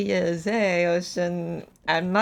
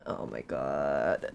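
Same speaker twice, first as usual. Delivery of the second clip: low, slow groan of despair; 6 dB quieter, much slower than usual. Real, not acted. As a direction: drag it out low, defeated. Despair at looping again.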